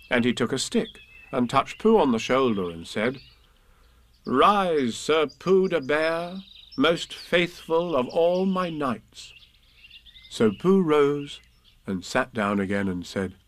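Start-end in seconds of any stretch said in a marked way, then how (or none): 4.2-9.3 slow, higher-pitched boy playing king; ceremonial knighting. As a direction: proclaim it solemnly and slowly as a child.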